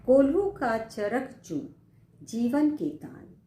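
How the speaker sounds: high, loud slow verse; poem recitation. Acted. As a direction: declaim it slowly. (ऊँचे स्वर में धीमा कविता पाठ)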